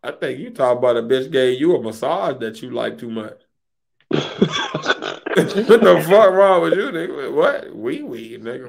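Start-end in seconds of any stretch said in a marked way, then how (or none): 4.1-5.3 a sudden high-pitched cackle at the joke; high burst of laughter. Real, not acted. Mocking his friend's joke with a high laugh.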